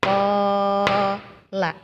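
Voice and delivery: Kola with the o held for two beats; Qur'an recitation, Kola with a long held o; long vowel. Shown as a demonstration. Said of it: "Dua harokat, dua ketukan".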